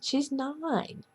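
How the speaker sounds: normal talking voice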